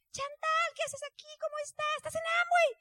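put-on high, surprised voice of an old acquaintance; acquaintance's surprised greeting. Acquaintance greeting the daughter at the seminar, high and surprised. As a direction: play a startled acquaintance spotting a friend.

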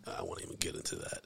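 unvoiced under-the-breath whisper; whispered aside. Real, not acted. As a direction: whisper it as if not meant to be heard. Muttered aside to himself.

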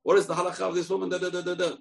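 high and fast, slipped in mid-call; the peddler slips a legal question to the prisoner; hawker's quick question. Acted. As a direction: sneak the question in between the calls. Disguised rabbi slips in a question.

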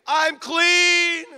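high, loud cry of joy; loud joyful shout. Acted. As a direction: shout it so everyone hears. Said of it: "Here's his tune now in a loud voice".

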